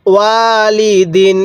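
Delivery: ওয়ালিদিন read slowly with one alif on ওয়া; slow recitation. Shown as a demonstration. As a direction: draw out ওয়ালিদিন syllable by syllable. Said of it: "এরকমভাবে শিখব".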